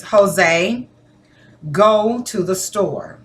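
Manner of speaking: slow, firm telling, falling at the end; firm directive. Shown as a demonstration. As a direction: give a deliberate order. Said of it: "That is me telling you, giving you a directive".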